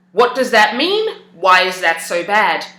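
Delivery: normal talking voice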